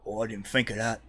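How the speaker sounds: lightly voiced henchman; mild thug voice, caught out; a touch of dim henchman; thug conceding. Thug answers his partner.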